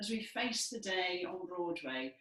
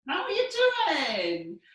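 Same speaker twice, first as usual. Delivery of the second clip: loud, higher calls of ciao; Italian greeting; 7 semitones higher, 8 dB louder, much slower than usual. Shown as a demonstration. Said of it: "And we might say".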